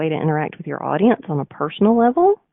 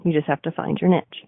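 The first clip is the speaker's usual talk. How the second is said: ordinary talk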